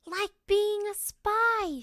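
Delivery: high, loud, slow thrilled shout; excited shout. Acted. Girl thrilled by the secret game, high excited shout. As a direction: stretch it out with delight. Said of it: "shouted Lisa".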